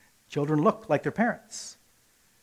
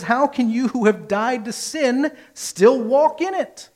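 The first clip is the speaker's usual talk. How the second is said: rhetorical challenge; 7 semitones higher, 4 dB louder, slower than usual. Retold or imitated. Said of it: "Paul says in Romans six".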